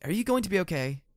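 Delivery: worried high question; concerned. A narrator's reading. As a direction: ask with real concern. Narrator checks on injured friend.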